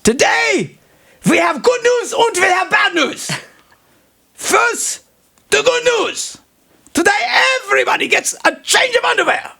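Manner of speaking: put-on high, booming German accent; German commandant accent. Joke told to the host, mock German accent. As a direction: announce it like a German camp commandant. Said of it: "talking in a German accent".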